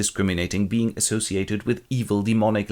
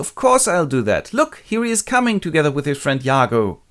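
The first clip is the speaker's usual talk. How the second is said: higher, louder eager reply; lightly voiced helpful Desdemona, pitched up; obliging wife; 7 semitones higher, 4 dB louder than usual. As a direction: agree readily, lilting high. Desdemona cheerfully agrees.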